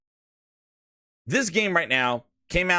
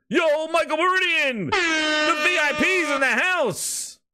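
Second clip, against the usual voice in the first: hype announcer call; 10 semitones higher, slower than usual. Real, not acted. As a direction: call them in like a ring announcer. Hype intro calling out players.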